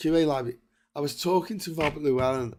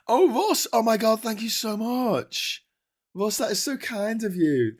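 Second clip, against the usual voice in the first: excited thanks; 9 semitones higher, 4 dB louder than usual. Real, not acted. Thanking a viewer for donation.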